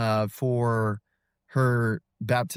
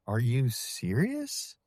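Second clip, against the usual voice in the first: stunned disbelief; 4 dB quieter, slower than usual. Retold or imitated. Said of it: "she just lost it".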